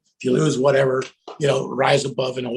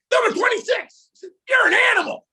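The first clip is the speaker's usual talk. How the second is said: high, loud shouted praise; hyped-up fan yell; sideline cheer; 3 dB louder than usual. Retold or imitated. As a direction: holler it to a player on the field.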